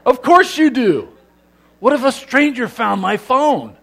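normal talking voice

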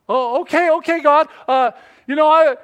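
loud, high, stumbling reply; flustered climber. Acted. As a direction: stammer it upward, loudly. Climber flustered at hearing God.

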